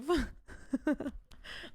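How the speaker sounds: trailing giggle; laugh. Real, not acted. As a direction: giggle after the silly word. Laughs at chatter's typo.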